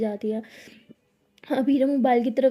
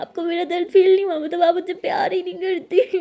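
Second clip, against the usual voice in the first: higher, crying, breaking; sobbing; 5 semitones higher, 5 dB louder than usual. A narrator's reading. Abira sobbing to Anokhi that she's unloved. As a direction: wail it through sobs.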